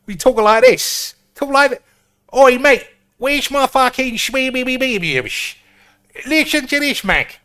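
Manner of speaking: loud, high-pitched exaggerated Cockney; Cockney accent. Shown as a demonstration. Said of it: "That's Cockney".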